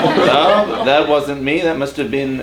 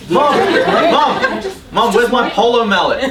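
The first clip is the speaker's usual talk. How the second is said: yelling across the room; 7 semitones higher than usual. Acted. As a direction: kid calling mum from afar. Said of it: "kid yelling for mum".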